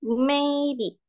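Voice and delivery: MAYBE drawn out with MAY high and level; first tone. Shown as a demonstration. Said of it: "untuk nada satu". (maybe dengan nada satu, tinggi dan rata)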